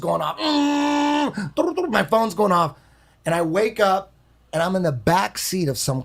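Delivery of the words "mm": high held hum; imitated phone buzz. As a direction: mimic a phone buzzing with a closed-mouth "mm". Imitates his phone going off.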